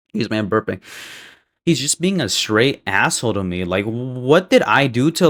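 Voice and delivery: usual speaking voice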